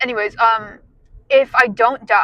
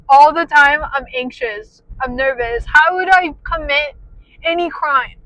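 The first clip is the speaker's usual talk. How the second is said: normal talking voice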